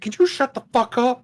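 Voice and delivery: mock furious yell; angry shout. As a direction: snap at the woman behind. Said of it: "I said".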